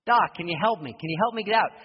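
loud, high plea to the doctor; shouting up from the pit; pleading yell. Retold or imitated. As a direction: beg him to help you out. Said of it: "Keeps yelling, pleading".

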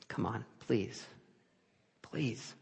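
soft, hushed pleading. Retold or imitated. Preacher reliving a desperate prayer, soft pleading. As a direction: beg quietly. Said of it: "all the words I used".

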